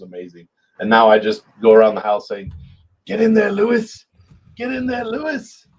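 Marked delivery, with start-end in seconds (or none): 3.1-4.1 high, loud mock British cheer; terrible British impression. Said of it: "my terrible impression". 4.6-5.7 very high mock British cheer; terrible British impression, higher. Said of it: "my terrible impression".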